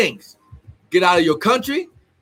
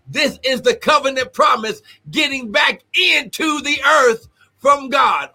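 climactic preaching shout; 5 semitones higher than usual. Real, not acted. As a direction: ring it out to the back row.

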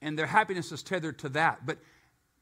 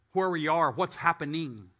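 normal talking voice

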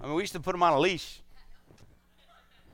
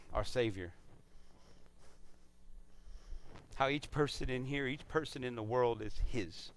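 quiet preaching; 3 semitones lower, 8 dB quieter than usual. Acted. Pastor tells the congregation about the Savior's love, softly. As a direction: bring it down, tender and reverent.

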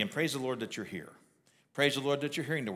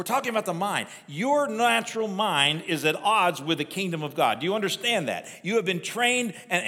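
higher and louder, hammering each point home; full-force preaching; 5 semitones higher, 5 dB louder than usual. Acted. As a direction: preach it hard to the congregation.

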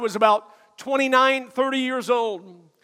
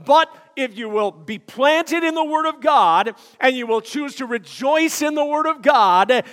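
normal talking voice

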